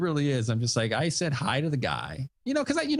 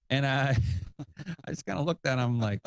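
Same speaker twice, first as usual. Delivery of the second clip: ordinary delivery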